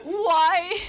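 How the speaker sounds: loud, high, laughing yell; laughing shout. Real, not acted. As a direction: yell it out in disbelief. Shouting at cohost's prank.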